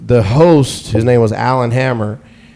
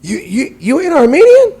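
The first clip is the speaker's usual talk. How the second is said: much higher, incredulous squeak; shocked disbelief; 4 dB louder than usual. Retold or imitated. The Armenian guy realizing he's not Armenian, shocked. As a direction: gasp it out in disbelief. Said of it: "he immediately went into an urban accent".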